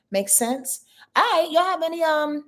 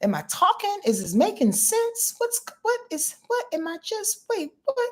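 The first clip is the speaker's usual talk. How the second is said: higher, rising, bewildered questions; mock confused; 11 semitones higher than usual. Host showing her own confusion talking to silent viewers. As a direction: act lost and confused. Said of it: "from my point of view, it's like".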